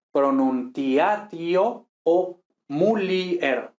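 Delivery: "pronunciatio o mulier" very slow, ti-a-ti-o and mu-li-er; hiatus. Shown as a demonstration. Teacher models Latin hiatus, slow separate syllables. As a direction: stretch "mulier" into MU-li-er, i apart from e. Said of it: "constituidos por i más vocal".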